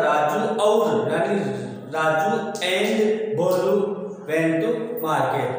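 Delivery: slow, word by word; slow explaining. Shown as a demonstration. Teacher slowly translates the sentence for students, word by word. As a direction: spell the sentence out slowly for the class. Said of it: "वो और होता है".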